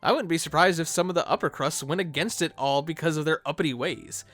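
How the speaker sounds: ordinary talk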